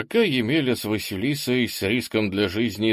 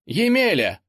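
second call, much higher and louder; зовёт громче; 10 semitones higher, 5 dB louder than usual. A narrator's reading. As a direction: call again more urgently. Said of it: "позвала Василиса". (настойчивый громкий оклик)

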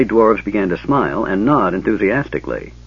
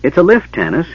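high, excited burst of discovery; merry kender; 8 semitones higher, 3 dB louder than usual. A narrator's reading. Kender runs back to report to his friend, merrily. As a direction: announce it with bubbling delight. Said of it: "eyes shining with merriment".

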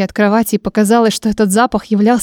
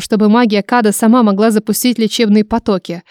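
ordinary delivery

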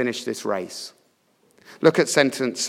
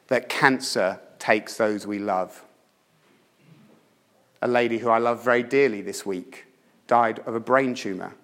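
ordinary talk

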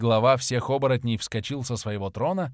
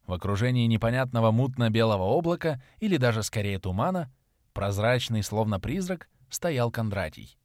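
ordinary delivery, nothing marked

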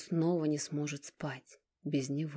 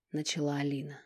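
ordinary delivery

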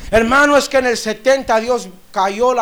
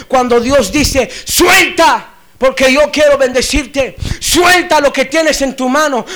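full-force preaching; 3 semitones higher, 6 dB louder than usual. Acted. Preacher urging the congregation to let go, fiery preaching. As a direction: preach it hard, bursting into shouted commands.

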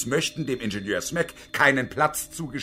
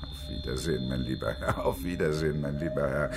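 low, soft repetition of a farewell; hollow echo; 9 semitones lower, 5 dB quieter than usual. Acted. Engineer echoes the woman's mocked goodbye, low and subdued. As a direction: murmur it back low, almost to yourself.

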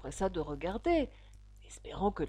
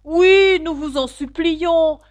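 very loud, high, drawn-out plea; pleading chorus cry; 10 semitones higher, 16 dB louder, slower than usual. A narrator's reading. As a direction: cry out like a whole crowd begging. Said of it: "dirent en chœur". (supplication criée à pleine voix)

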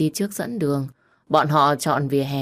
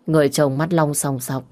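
plain reading voice

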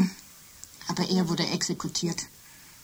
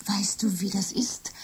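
whisper; 5 dB quieter than usual. Acted. Intimate confession between women.

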